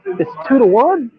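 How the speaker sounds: very high, loud, drawn-out protest; incredulous protest. Real, not acted. Co-host arguing the odds to host, incredulous. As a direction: squeal it slowly, loud and exasperated.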